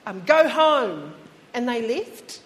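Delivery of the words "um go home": slow, louder command to go home; telling bees to leave. Retold or imitated. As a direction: shoo the bees off, drawn out. Keith shooing bees away.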